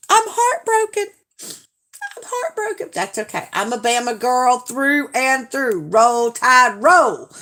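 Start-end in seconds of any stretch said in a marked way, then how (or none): 0.1-1.1 much higher, wailing mock heartbreak; mock heartbroken. Answering a rival fan in chat, mock heartbroken wail. Teased over her football team. 1.4-2.9 much higher, softer and slowed mock sorrow; softer mock heartbroken. Repeating it to the rival fan, softer mock sorrow. Teased over her football team. 5.9-7.3 louder fan chant; team cheer. Real, not acted. Bama girl cheering her team, louder chant.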